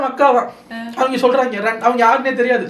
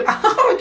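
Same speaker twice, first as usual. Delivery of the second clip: high burst of laughter; 13 semitones higher than usual. Real, not acted. Mocking the heckler.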